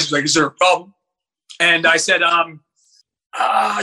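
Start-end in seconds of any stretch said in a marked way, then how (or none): 0.2-0.9 lightly lowered, imposing man's voice; a hint of deep-voiced authority; imitated deep voice. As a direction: drop into a mock deep voice. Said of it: "well, with his deep voice".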